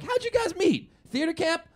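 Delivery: high-pitched gushing small talk; chummy enthusiasm. Retold or imitated. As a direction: gush like a sudden best friend. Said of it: "all of a sudden they're our best friends".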